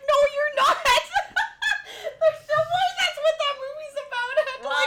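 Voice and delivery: high loud laughter; laughing. Real, not acted. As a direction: laugh through the words. Disbelieving laughter retelling.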